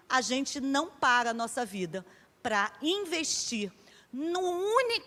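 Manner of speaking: ordinary delivery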